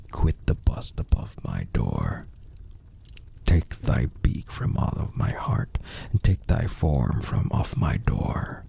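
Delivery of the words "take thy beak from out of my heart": usual speaking voice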